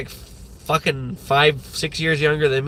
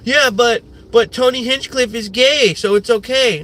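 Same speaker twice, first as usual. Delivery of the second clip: mocking Rogan fan; 7 semitones higher, 3 dB louder than usual. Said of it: "the fucking Joe Rogan types".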